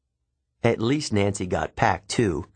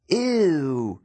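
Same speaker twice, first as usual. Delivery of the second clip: put-on disgusted girl; 7 semitones higher than usual. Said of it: "said one of the girls behind me".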